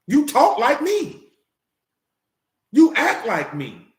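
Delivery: each word spaced out slowly; slow emphatic. Real, not acted. Slow, pointed words to women who act like men.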